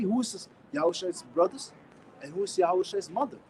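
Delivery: ordinary delivery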